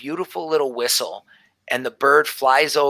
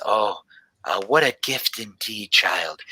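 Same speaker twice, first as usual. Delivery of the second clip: lower, measured fatherly voice; old father; 5 semitones lower than usual. Acted. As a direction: speak down gently to the child as her father.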